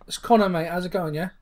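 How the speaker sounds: put-on British lad accent; mock British accent. American host teasing his British guest, mock British accent. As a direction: imitate a chummy Brit, playfully overdone British.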